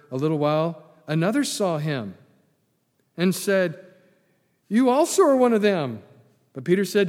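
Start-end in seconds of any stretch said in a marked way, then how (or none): 4.7-6.0 high, raised accusing voice; loud accusation. Acted. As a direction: call him out loudly. Bystander accuses Peter, acted.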